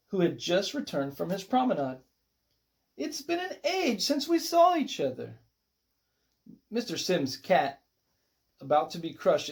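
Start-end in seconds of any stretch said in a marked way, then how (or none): 3.0-5.4 high gushing star voice; theatrical soap-star greeting; gushing diva. A narrator's reading. Diva greets old friend.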